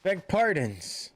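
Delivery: lightly voiced formal military envoy; a hint of a gallant officer, measured delivery; courtly officer. Maelstrom officer greets the lady and offers the hero a place, lightly voiced courtly officer.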